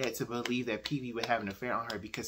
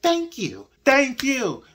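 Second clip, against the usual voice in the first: emphatic thank you; 13 semitones higher, 12 dB louder, much slower than usual. Retold or imitated. Emphatic agreement with character's point.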